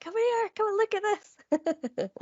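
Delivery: high, slow excited call to someone in the house; excited shouting. Retold or imitated. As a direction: re-enact calling your fiance over, thrilled. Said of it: "Shouting down to my fiance".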